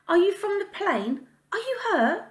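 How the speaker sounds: put-on high child's voice, worried questions; anxious child. A girl in a bush asking the stranger if he crashed, anxious. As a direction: ask like an anxious little girl.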